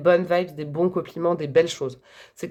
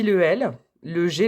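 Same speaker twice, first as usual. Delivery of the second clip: normal talking voice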